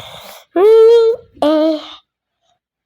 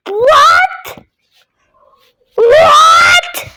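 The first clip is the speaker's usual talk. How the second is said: high loud disbelieving yell; raging gamer; 7 semitones higher, 3 dB louder, much slower than usual. Real, not acted. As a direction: shout at the screen. Raging after another death.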